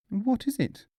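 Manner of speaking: wife's voice pitched well up; a drowsy wife woken at night; high sleepy wife. A narrator's reading. Wife woken at night asks, high voice. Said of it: "she said".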